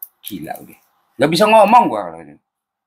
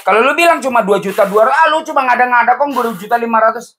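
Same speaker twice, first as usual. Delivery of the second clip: higher, teasing mock complaint; poking fun at online critics of the price, higher and teasing; mocking netizen; 9 semitones higher than usual. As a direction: parrot the doubters in a scoffing voice.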